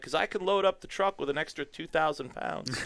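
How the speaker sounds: playful sarcastic aside; mock sarcasm. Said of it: "I'm like".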